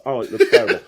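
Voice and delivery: loud, laughing groan at own video; laughing dismay. Retold or imitated. Magician watching his own bad video, laughing loudly. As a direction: laugh it out, half horrified.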